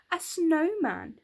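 high, loud, slow exclamation; surprised. A narrator's reading. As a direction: announce it with wonder. Sudden discovery in story.

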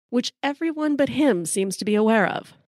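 usual speaking voice

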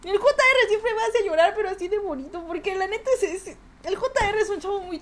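high, loud emotional thanks; touched by the donor's gift; on the edge of happy tears; moved to tears. Real, not acted. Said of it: "me van a hacer llorar".

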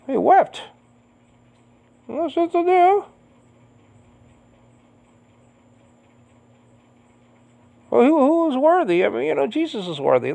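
very high, choked real crying voice; tearful. Real, not acted. Preacher telling how John wept, tearful himself. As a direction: struggle through it on the edge of sobbing.

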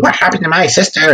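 a hint of a wicked witch asking after her sister. Teacher quoting the Wicked Witch, lightly voiced witch. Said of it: "comes, and she goes".

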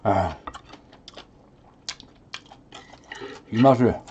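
talking while chewing rice; muffled speech through food; mouth full, chewing. Real, not acted. Seen 1: Cheeks bulge with food and jaw works throughout; eats kimchi at 2.0.

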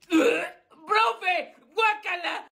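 loud grossed-out cry; disgusted shout. Acted. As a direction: yell in disgust. Disgusted at thermometer.

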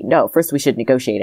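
usual speaking voice

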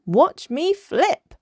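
high, loud, drawn-out show-off call; excited cry. A narrator's reading. Little dolphin showing off in splashing contest, excited cry. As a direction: call it out high and slow, word by word. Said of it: "cried Minnie Maui".

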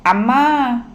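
put-on child calling for Amma, lifted voice; calling out for mother. A narrator's reading. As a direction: call out to your mother. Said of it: "పిలిచాడు".

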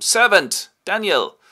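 a high, loud summons across the castle; calling out. Acted. Franz summoning old Daniel with a loud, high call. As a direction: bellow for the servant.

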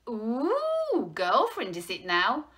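loud, high, delighted cooing; animated, sing-out curiosity; bright teasing. A narrator's reading. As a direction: tease with nosy delight. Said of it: "said the newsagent brightly".

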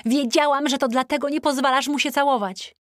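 wyżej i głośniej, triumfalnie; podekscytowany okrzyk. A narrator's reading. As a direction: wykrzycz z triumfem, że się wiedziało. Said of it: "krzyknęła". (Tina triumfalnie wykrzykuje, że wiedziała, wyżej i głośniej)

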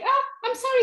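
high-pitched, acted fearful apology; frightened dragon. A narrator's reading. Dragon backing away from the horrible beast, frightened apology. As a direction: jump up high, a dragon scared off. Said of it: "he started to shake".

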